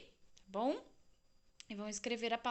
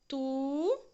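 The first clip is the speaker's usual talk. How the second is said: slow, drawn out "tu"; 2 semitones higher, 5 dB louder than usual. Shown as a demonstration. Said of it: "observar a separação das sílabas".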